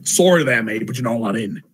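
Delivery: mock Aussie bouncer, punchy then quick; hammed-up Australian refusing entry; gruff Australian accent. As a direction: snap it like an Australian doorman. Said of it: "in a gruff Australian accent".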